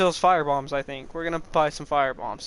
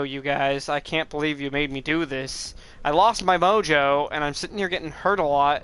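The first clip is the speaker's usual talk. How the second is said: ordinary delivery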